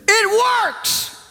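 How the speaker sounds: high, joyful shout; excited shout. Retold or imitated. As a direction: blurt it out high with delight. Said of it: "exactly what he heard".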